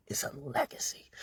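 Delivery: breathy whisper on legacy, rising. Pretend. Sealing her boast to the viewer in a whisper. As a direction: drop to a secret whisper.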